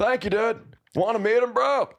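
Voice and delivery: high-pitched dude-bro impression; Sebastian Stan. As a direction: push the voice way up, stretched and silly. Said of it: "I wanted to do my impression of him".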